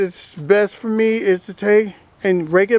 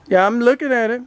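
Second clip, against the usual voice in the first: normal talking voice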